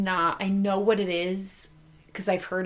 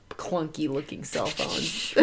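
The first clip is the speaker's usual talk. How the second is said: ordinary delivery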